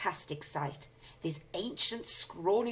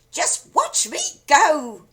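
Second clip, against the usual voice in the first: screechy crone; 10 semitones higher, 13 dB louder, slower than usual. A narrator's reading. Grandma gloating.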